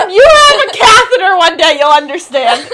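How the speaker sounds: loud, laughing comeback; laughing loud retort. Real, not acted. To her teasing roommate, a loud laughing comeback. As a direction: fire back through laughter.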